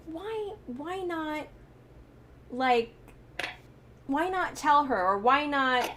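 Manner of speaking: high, drawn-out exasperated plea. Retold or imitated. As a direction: replay your frustration with a pleading, emphatic why. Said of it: "was so mad that".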